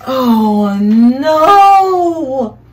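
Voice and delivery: loud, startled cry of dismay. Acted. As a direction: gasp it out as the boat vanishes. Boat disappears into grate.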